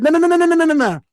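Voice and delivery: rapid string of high, loud noes; emphatic retold refusal. Acts out vetoing friend's plan.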